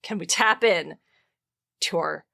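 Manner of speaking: usual speaking voice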